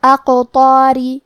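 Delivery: slow recited "اقطاری" with the ق bounced; qalqalah bounce. Shown as a demonstration. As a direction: recite "اقطاری" slowly, echoing the heavy ق. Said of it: "حرف القاف کو ہلاتے ہوئے".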